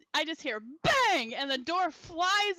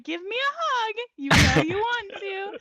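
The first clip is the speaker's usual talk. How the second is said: high, rising coaxing re-enacted; playful insistence; 5 semitones higher, 5 dB louder than usual. Drunk girl pushes Mikey after his refusal, insistent. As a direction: pester him louder, pitched right up.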